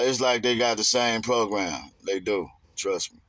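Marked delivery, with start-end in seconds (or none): none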